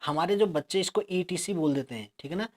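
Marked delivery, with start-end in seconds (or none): none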